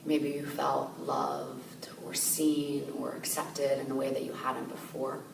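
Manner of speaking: calm, softened voice with long pauses; guided meditation. Real, not acted. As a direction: gently guide listeners inward, leaving space to breathe.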